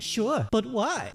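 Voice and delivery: high, slow, questioning Brig voice; a curious Brig. Retold or imitated. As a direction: sound agreeable but suspicious. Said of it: "That's my Brig impression".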